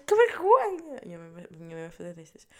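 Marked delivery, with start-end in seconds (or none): 0.0-1.0 much higher, louder mock exclamation; mock shocked mother. Mother telling everyone, higher and louder mock scandalised. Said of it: "a dizer a toda a gente".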